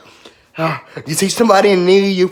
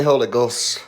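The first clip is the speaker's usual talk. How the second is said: ordinary talk, nothing marked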